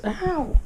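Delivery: sudden high yelp of pain; sharp pained yelp. Real, not acted. Bitten by a playful puppy, a real yelp of pain. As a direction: cry out as the teeth catch you. Puppy bites during filming.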